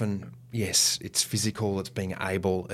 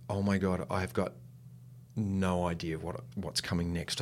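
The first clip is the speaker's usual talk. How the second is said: quiet panicked thought; 2 semitones lower, 9 dB quieter than usual. Retold or imitated. Actor lost mid-show, thinking in quiet panic. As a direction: murmur it to yourself, lost.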